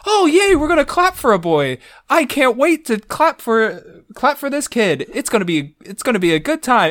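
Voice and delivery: high, gleeful mock enthusiasm; hammed-up squeal of delight; gushing excitement. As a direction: gush like an over-eager cheerleader. Retold naive eagerness to clap.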